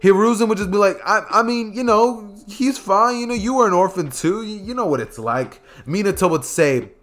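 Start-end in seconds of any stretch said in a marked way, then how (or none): 1.0-5.6 high, sheepish excuses; squirming excuses. Acted. Old Hokage dodging blame to an angry Minato, high sheepish. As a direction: talk your way out of trouble, voice up high.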